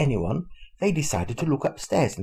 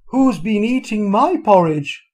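higher, louder, slow Mother Bear; middle-sized bear voice; 7 semitones higher, 6 dB louder than usual. A narrator's reading. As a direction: cry out in a raised Mother Bear voice. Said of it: "in her middle-sized voice".

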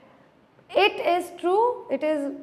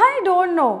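usual speaking voice

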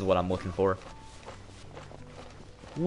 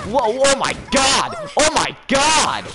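high, loud, drawn-out shout; startled shout; 9 dB louder, slower than usual. Real, not acted. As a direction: yell in shock. Startled by enemy attack.

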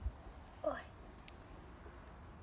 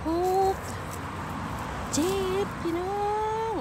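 excited; 5 semitones higher, 6 dB louder than usual. Real, not acted. Counts mushrooms while picking, excited.

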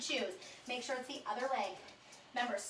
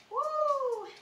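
excited whoop; 11 semitones higher, 4 dB louder than usual. Real, not acted. Cheering after the burn.

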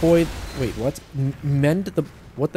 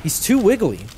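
higher and louder, fed up; exasperated outburst; 9 semitones higher, 6 dB louder than usual. Real, not acted. Gamer fed up with a dodging scout, loud exasperated outburst.

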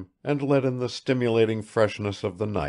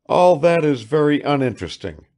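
raised, higher and louder voice; said aloud; 8 semitones higher, 9 dB louder than usual. A narrator's reading. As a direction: speak up clearly, pitched high. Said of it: "he said aloud".

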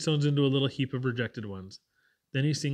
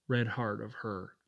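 slow, hushed and tender, much softer; softly closing a tender declaration; 3 semitones lower, 6 dB quieter, slower than usual. Acted. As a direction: let the last words melt with love. Freckles tenderly describes his love.

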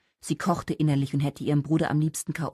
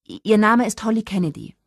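high, louder, caught off guard; flustered stammer; 6 semitones higher, 7 dB louder than usual. A narrator's reading. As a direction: stumble on the first word, then blurt. Caught unprepared, stammers her own name. (ertappt stotternd, hoch und laut herausgeplatzt)

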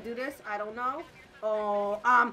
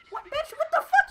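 high, indignant scolding; affronted, raised-pitch rant; indignant outburst; 14 semitones higher, 6 dB louder than usual. Real, not acted. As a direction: go up high in outrage. Indignant at game character.

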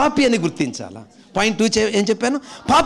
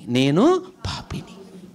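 humble confession; 3 semitones lower, much slower than usual. Pretend. Voicing a sinner's confession.

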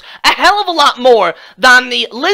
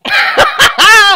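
high burst of mocking laughter; delighted mocking laugh; gleeful laugh; 11 semitones higher, 3 dB louder than usual. Real, not acted. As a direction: cackle at what he sees. Mocking Polston's video, gleeful laugh.

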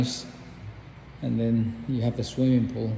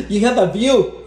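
laughing through the words; giggling delight; 9 semitones higher, 4 dB louder, faster than usual. Real, not acted. Laughing at laundry room view.

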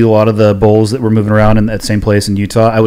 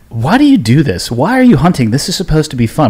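higher, pleading wife's voice, imitated; mock bewildered questions, a bit higher; exasperated wife; 5 semitones higher than usual. Husband voicing his wife asking why he hunts. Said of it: "And she, she'll pause for a second".

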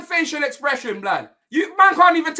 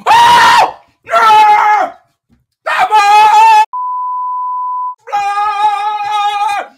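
huge, loud roar of disbelief; ecstatic shout; 3 semitones higher, 7 dB louder, much slower than usual. Real, not acted. Fan erupting at the penalty, ecstatic shouting. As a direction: bellow it out, holding each word.

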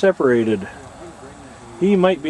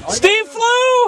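excited; 3 dB louder than usual. Real, not acted. Cheering Steve's first flight.

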